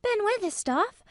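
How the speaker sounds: put-on high girl's voice, calling up; gasping call. A narrator's reading. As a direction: call out urgently, pitched high. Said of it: "with a sort of gasp".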